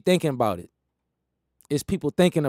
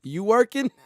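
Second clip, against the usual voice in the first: mock nagging question; 6 semitones higher than usual.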